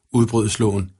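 usual speaking voice

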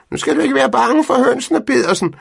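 high, loud teasing call across the field. A narrator's reading. Fru Andersen calling across the field to Pedersen, loud and high. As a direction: shout laughing at the neighbour. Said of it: "råbte hun".

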